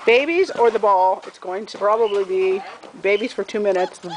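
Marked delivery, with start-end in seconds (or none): none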